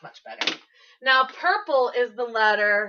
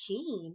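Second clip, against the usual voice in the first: "Keen" said alone, soft and falling; new word repeated; 3 semitones lower, 10 dB quieter than usual. Shown as a demonstration. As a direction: isolate the word "Keen" for the class. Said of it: "Do you know what that means?".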